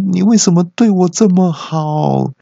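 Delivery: high, hammed-up weepy voice; fake-cry it, overacted; mock sobbing. Boss mock-sobs while hugging his customer friend. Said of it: "老板就边哭边说".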